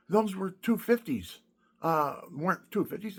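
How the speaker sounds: ordinary talk, nothing marked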